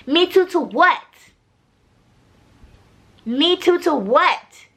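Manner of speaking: pointed, rising question; incredulous challenge. Real, not acted. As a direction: demand to know what he means. Challenges the character's vague reply.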